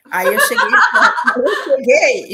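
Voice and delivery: contando aos risos, mais alto e mais agudo; rindo. Real, not acted. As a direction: solta uma risada no meio da frase. Rindo ao lembrar da cena. (rindo alto ao lembrar da filha)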